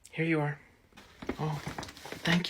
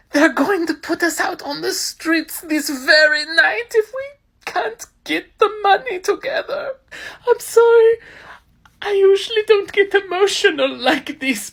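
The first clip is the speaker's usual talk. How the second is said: high, wailing fake sobs over the rent; hammed-up crying to beg for money; 13 semitones higher than usual. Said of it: "he's putting on quite a performance".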